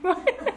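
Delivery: a quick, high giggle; real giggle. Real, not acted. Telling the birth-speech story, a short, high giggle. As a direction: giggle briefly.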